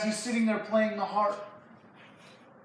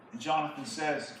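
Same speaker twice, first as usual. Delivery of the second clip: normal talking voice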